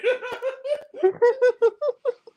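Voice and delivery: helpless high-pitched laugh; real high laughter. Real, not acted. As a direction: lose it completely. Cracking up at Kimmel clip.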